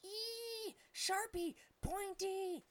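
very high squealing ape cries; screeching ape. Acted. Wounded big ape shrieks about the arrow, in a very high ape screech. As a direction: shriek in panic like a monkey. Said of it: "screeched at the top of his lungs".

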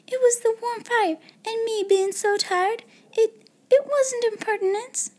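very high, pleading little maid; high-pitched, faltering excuse; anxious protest. A narrator's reading. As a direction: plead your innocence, almost tearful. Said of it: "protested Becky".

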